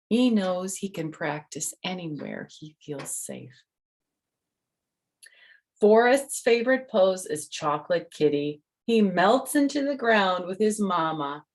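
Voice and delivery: bright storybook reading voice; reading to children. Acted. The author reads her book to the kids, bright storytelling. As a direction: read it out to little listeners.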